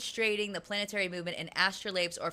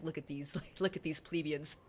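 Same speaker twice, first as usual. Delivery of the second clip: low, softer mock disdain; superior genius; 4 semitones lower, 5 dB quieter than usual. Genius in chat sneering at plebeians, low mock-superior. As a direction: lightly play a genius looking down on everyone. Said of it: "high-tier genius in chat and is like".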